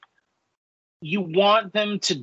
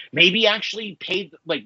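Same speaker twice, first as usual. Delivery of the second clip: ordinary delivery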